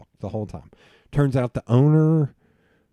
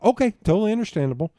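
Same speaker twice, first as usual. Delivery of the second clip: quick agreement; 9 semitones higher, faster than usual. Retold or imitated. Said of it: "I was like".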